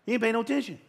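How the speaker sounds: high, sassy street paraphrase; casual slang. Shown as a demonstration. Preacher explaining the verse in street talk, sassy and high. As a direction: toss it off like everyday slang. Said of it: "What does that mean?".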